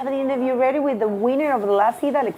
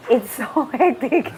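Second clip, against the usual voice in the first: laughing; 2 semitones higher, 5 dB louder than usual. Real, not acted. Laughing at the shallow wave.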